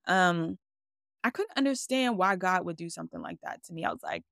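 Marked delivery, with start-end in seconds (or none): none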